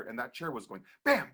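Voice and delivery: normal talking voice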